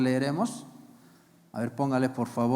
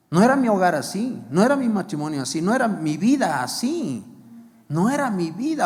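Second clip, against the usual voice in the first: lament; 5 semitones higher, 4 dB louder, faster than usual. Acted. Voicing a lost believer's lament.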